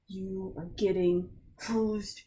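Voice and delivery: grind it out with contempt; angry guard. Acted. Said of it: "hear the anger in his voice".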